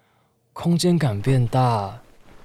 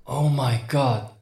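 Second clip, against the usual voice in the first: slow, high astonished exclamation; surprised; 5 semitones higher, much slower than usual. Acted. Woman discovers he bought her a double bed, stunned. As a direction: stretch it out in disbelief.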